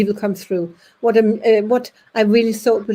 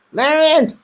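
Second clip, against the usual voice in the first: 1 calling out a name; 6 semitones higher, 5 dB louder than usual. Retold or imitated. Man calling out during seance.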